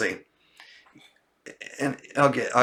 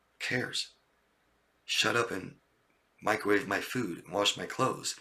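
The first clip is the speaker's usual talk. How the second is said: much softer, low, dismissive; softer, lower, hushed contempt at times; cold narcissist voice; 4 semitones lower, 8 dB quieter than usual. Retold or imitated. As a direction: murmur it like an entitled, contemptuous partner. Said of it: "Could imagine acting like that".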